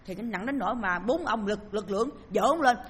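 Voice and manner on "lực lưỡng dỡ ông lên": ordinary talk, nothing marked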